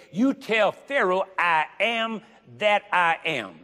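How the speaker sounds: high, loud and slow, preaching at full force; a preacher hammering home God's command; fervent preaching. Acted. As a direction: lift it up and drag out each word.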